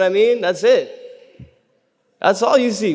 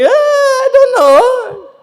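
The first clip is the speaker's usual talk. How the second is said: doubtful audience member; 12 dB louder, much slower than usual. Retold or imitated. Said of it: "You're like".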